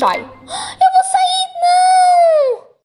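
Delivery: high, drawn-out dramatic cry; gasp, then wail in protest, ending loud; desperate scream. Acted. Expulsa do jogo por votação. (grito agudo de desespero)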